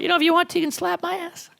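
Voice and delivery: put-on high-pitched little-kid voice; put-on childish squeak; four-year-old girl. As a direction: ask like a small child, voice way up high. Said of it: "She acted like a four-year-old".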